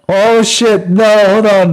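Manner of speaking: high, loud real alarm; alarmed outburst. Real, not acted. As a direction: blurt it in sudden panic at a mistake. Botched the map reveal.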